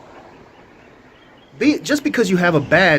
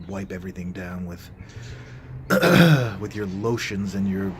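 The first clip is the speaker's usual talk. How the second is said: ordinary delivery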